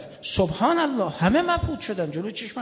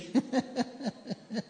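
crowd laughter; 5 semitones higher, 7 dB quieter than usual. Real, not acted.

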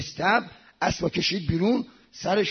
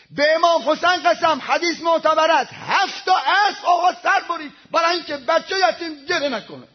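high, forceful pulpit oath; fervent, raised preacher's voice; impassioned preaching; 8 semitones higher, 5 dB louder than usual. Real, not acted. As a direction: swear it to the congregation at full force. Preacher swears the story is true.

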